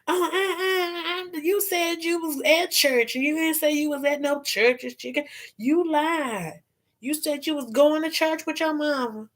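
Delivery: high, soft mock-outraged girlfriend; hammed-up wronged girlfriend, high; angry girlfriend. Wronged girlfriend confronting her man on Cheaters, mock outrage. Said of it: "some angry bitch behind the host".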